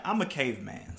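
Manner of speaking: ordinary talk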